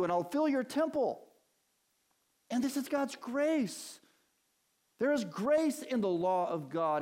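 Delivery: high, loud, ringing preacher's voice; fervent preaching. Acted. Preacher urging the congregation about God's grace, full force.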